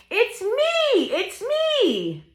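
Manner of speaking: very high, sing-song reveal with stretched ME; gleeful high animal voice for kids; excited hen. Acted. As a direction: squeal it proudly as the hen.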